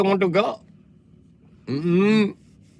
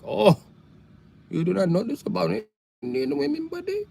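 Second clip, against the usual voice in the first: put-on Indian dad accent; 5 semitones higher, 4 dB quieter than usual. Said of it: "He was like".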